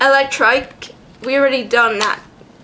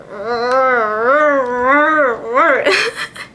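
long wavering trilled creature noise; imitated Krabby noise. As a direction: imitate a creature's drawn-out warble. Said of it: "It's like".

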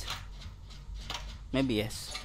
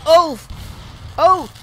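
startled shout; 12 semitones higher, 4 dB louder than usual. Real, not acted. Jump scare in game.

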